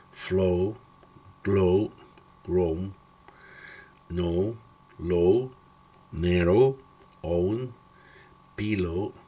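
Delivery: "arrow", "blow", "snow" with a long English O. Shown as a demonstration. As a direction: read "pillow", "follow", "glow" slowly as a model. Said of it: "se pronuncia como ow".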